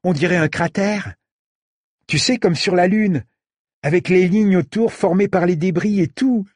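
marvelling at a crater; excited boy. A narrator's reading. Said comparing the wound to the Moon, excited.

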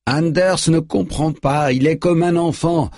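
high, slow, stretched-out call. A narrator's reading. Manager pleading for Anders from behind, high drawn-out call. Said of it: "lance le gérant derrière eux".

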